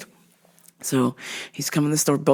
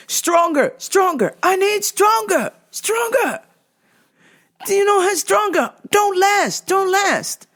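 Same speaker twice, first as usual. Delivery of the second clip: much higher, strained, broken English pleading; a mock desperate customer begging for a stronger cream; 14 semitones higher, slower than usual. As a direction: squeak out the demand like a desperate, out-of-it customer.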